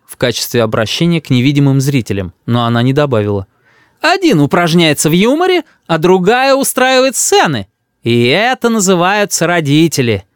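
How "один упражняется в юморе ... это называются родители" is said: high, oratorical reproach; indignant grandmother. A narrator's reading. Grandmother denounces both parents, indignant. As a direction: scold the parents before an audience.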